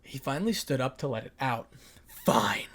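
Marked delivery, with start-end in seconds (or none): 2.1-2.7 cracked whisper. Acted. Issei breaks, voice cracks.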